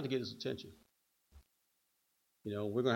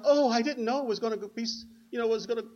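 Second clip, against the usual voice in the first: high, louder gushing mimicry; excited mother; 10 semitones higher, 5 dB louder than usual. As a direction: imitate a thrilled mom marveling at sudden fame. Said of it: "She's excited".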